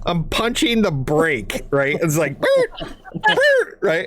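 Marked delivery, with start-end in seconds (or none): 2.4-3.8 high laugh. Real, not acted. Imitating the car.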